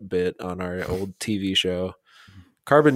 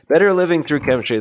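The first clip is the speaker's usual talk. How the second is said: fast, loud and higher; brisk title announcement; 7 semitones higher, 6 dB louder, faster than usual. Real, not acted. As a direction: rattle the title off in one go. Same title rattled off confidently.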